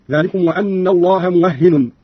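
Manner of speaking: "موهن" as muwahhinun; doubled ha, tanwin. Shown as a demonstration. Teacher showing Qalun's reading, "موهن" said muwahhinun. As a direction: open the waw, double the ha in "موهن", end in -un. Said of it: "وتشديد الهاء وتنوين النون".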